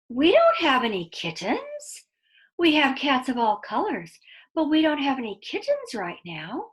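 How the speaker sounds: put-on expressive read-aloud to children; lively storyteller voice for kids; story narration. A narrator's reading. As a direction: tell it like a bedtime story, drawing them in.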